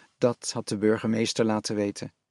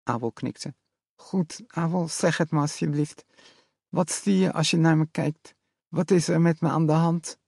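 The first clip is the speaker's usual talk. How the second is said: frightened lisping mother; 5 semitones higher than usual. A narrator's reading. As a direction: beg him to tell her the truth. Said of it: "zei ze zacht".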